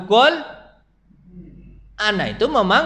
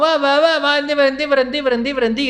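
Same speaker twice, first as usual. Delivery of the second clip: louder, higher calling stop; mother scolding child in rain, higher; 6 semitones higher, 4 dB louder than usual. Retold or imitated. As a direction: call out to stop the boy.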